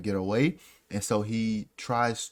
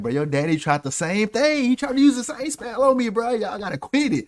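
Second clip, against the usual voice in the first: high, loud, comic exasperation; Snape; 12 semitones higher, 6 dB louder than usual. Retold or imitated. Snape scolding Harry for copying his father, exasperated high squawk. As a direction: voice soaring in mock outrage. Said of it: "He was like".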